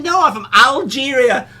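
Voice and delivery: high and loud through laughter; laughing punchline. Retold or imitated. The guest's answer retold, laughing loudly. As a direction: crack up on the line.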